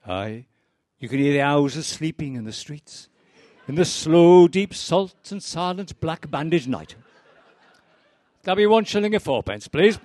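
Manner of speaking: lilting, dreamy barman; Welsh barman. Acted. Barman musing to the fisherman, then asking payment. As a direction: drift into poetry, then name the price. Barman replies and names price.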